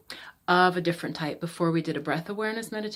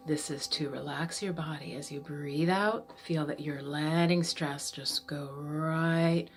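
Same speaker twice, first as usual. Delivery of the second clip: guided meditation; 3 dB quieter than usual. Acted. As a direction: ease them into the breath, unhurried. Leads breathing to relax.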